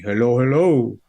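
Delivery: put-on thick Filipino accent greeting. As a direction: imitate a Filipino comedian's accent. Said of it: "with a really thick Filipino accent".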